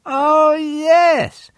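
high bright female voice; woman's voice. Acted. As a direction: lift into a high lady's voice. Mrs Lane voiced high.

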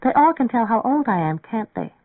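put-on young woman suddenly gloomy about her age; pessimistic young girl. A narrator's reading. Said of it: "with a rush of pessimism".

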